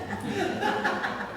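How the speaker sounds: breathy high giggle; high laugh. Real, not acted. Recalling Swami's scolding, a high giggle. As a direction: laugh it off, amused.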